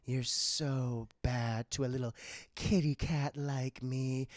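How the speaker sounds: low, slow seductive purr; low slow mock-seductive Catwoman; sultry Catwoman. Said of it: "she's like".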